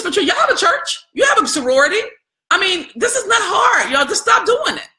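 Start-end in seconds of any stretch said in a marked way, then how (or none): 2.5-4.9 raised, higher voice, pleading with the audience; exasperated call-out. Real, not acted. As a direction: push it out, fed up and urgent. Urging her audience, exasperated.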